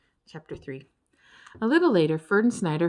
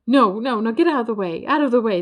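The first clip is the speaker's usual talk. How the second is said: louder, hurried shoo; 4 semitones higher, 8 dB louder than usual. A narrator's reading. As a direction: push him out of the way. Hyaenant shoos Ferd aside.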